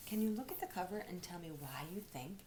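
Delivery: normal talking voice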